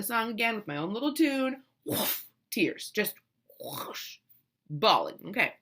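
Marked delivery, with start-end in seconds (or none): 1.9-2.3 breathy, voiceless whoosh; imitated gush of tears. Mother showing tears at the song, imitated gush. 3.6-4.2 long voiceless whoosh; imitated gush of tears. Mother showing how hard she bawled, imitated whoosh.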